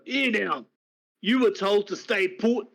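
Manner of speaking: a guard scolding a prisoner; gruff guard. Acted. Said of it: "roughly grab you and go".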